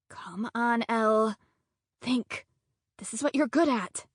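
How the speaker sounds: hushed self-urging. A narrator's reading. Narrator urging herself in her head.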